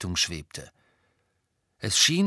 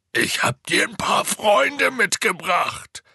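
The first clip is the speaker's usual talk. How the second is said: slow, high, loud shout; Hagrid; 11 semitones higher, 6 dB louder, slower than usual. A narrator's reading. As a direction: spell it out at full volume for a giant. Repeats louder for the giant. (langsam und laut gerufen)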